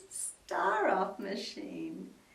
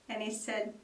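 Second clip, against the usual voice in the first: ordinary delivery, nothing marked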